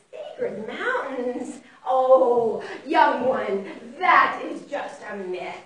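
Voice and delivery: put-on slow, drawn-out old mouse voice; wise old mouse. Old mouse dismissing the journey, slow and knowing. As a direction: scoff slowly as an old mouse. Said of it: "the wise old mouse was saying".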